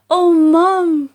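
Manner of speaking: high, loud breathless cry; frightened gasp. A narrator's reading. Jack back down the beanstalk, breathless and scared. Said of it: "he gasped".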